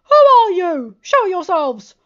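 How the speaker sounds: high, very loud demand. A narrator's reading. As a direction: demand they show themselves, unafraid. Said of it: "unfaltered and demanded".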